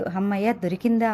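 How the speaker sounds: ordinary delivery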